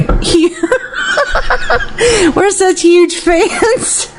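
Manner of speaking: giggling, broken by hiccups; laughing with hiccups. Real, not acted. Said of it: "I have the hiccups".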